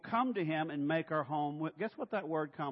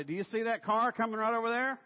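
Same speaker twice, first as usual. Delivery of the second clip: high, loud, fretful coaching from the passenger seat; backseat-driver nagging; 9 semitones higher, 7 dB louder than usual. Acted. Passenger to a driver they don't trust, loud nagging. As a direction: nag the driver in a raised, insistent voice.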